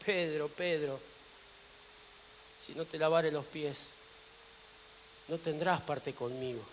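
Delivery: low, hushed and grave; soft warning. Acted. The Master warns Peter about washing his feet, quietly grave. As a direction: warn him very softly, voice dropped.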